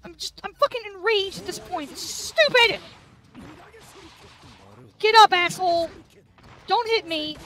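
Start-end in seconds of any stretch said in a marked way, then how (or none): none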